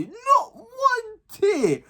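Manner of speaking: high, slow words through laughter; barely getting it out for laughing; giggle-strained words. Real, not acted. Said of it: "burst out laughing".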